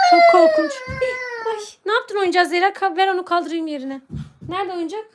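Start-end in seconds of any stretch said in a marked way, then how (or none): 0.1-1.6 high-pitched pretend fright ending in a gasp; hammed-up horror, pitched way up; mock terror. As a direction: squeal as if scared of the vampire. Pretend fear of vampire teeth.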